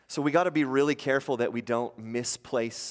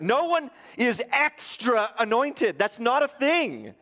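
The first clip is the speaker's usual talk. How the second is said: preaching at full force; 9 semitones higher, 5 dB louder than usual. Acted. Pastor preaching hard that no man is extra anointed. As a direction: drive it home from the pulpit.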